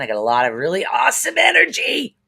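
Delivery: shouted high and loud with energy; pumped-up shout; excited burst. Real, not acted. Card reader hyping her viewers with big energy, shouted excitedly.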